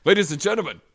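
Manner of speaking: loud, high announcer call with the stress on Fighters; Walken-style intro, FOO high and loud; Walken impression. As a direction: bellow it like Christopher Walken announcing the band. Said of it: "[name] does the perfect impression".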